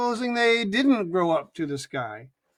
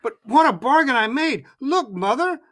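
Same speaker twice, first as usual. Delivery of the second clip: proud excitement; 6 semitones higher, 5 dB louder than usual. A narrator's reading. Jack shows off his bargain.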